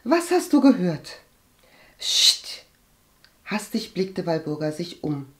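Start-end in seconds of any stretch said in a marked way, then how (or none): 0.0-1.2 higher, insistent demand; pressing question. A narrator's reading. Agathe presses her reluctant cousin again, insistent. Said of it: "drängte Agathe". 2.0-2.6 voiceless shushing sound; sharp hushing. A narrator's reading. Cousin silences Agathe with family nearby, hushing.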